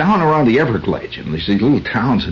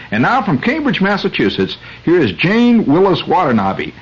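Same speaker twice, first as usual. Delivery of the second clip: high, bright mock newscaster intro; radio announcer; 9 semitones higher than usual. Radio host throwing to his reporter in a mock newscaster voice.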